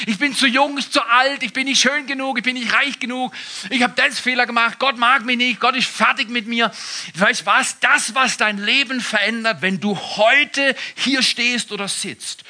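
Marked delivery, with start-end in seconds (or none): 0.1-6.7 high, loud, whining excuse voice; whiny excuses. Retold or imitated. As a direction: whine out every excuse.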